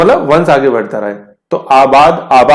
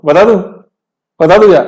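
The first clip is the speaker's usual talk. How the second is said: teasing, higher and louder; playful teasing; 4 semitones higher, 4 dB louder, faster than usual. Real, not acted. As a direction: dangle the answer playfully. Teasing students before answer. (बच्चों को छेड़ते हुए, ऊँचे स्वर में)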